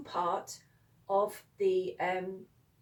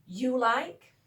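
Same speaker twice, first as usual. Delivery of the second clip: "You like" louder, swinging up at the end; emphatic question tone; 2 semitones higher, 5 dB louder than usual. Shown as a demonstration. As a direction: make "like" climb into a question. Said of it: "say it with that emphatic tone".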